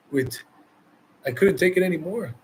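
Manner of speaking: normal talking voice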